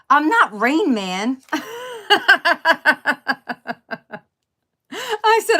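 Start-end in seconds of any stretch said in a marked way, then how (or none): 0.1-1.4 slow, laughing through it; quoting husband, cracking up. Retold or imitated. Wife quoting husband's joke, laughing. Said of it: "he goes". 1.5-4.2 high, loud burst of laughter; big laugh. Real, not acted. Wife cracking up at husband's joke, big laugh.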